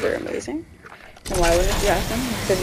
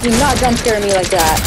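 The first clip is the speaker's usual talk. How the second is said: scared horror game streamer; 6 dB louder than usual. Real, not acted. After a jump scare.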